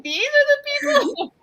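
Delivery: high, incredulous mock dismay; mock disbelief. Doc reacting to the people exposing him, mock incredulous. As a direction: start way up high, as if appalled. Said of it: "He's like".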